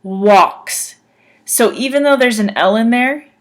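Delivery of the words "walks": walks said as wɑks, no L sound; careful pronunciation. Shown as a demonstration. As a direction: say walks with the L silent. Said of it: "you would say it like this".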